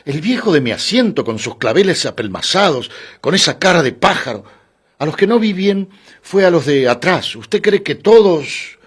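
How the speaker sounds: lightly acted, high-pitched complaint; irritated male passenger. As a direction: air a grievance, rising to an incredulous question. Irritated man recalls staring passengers.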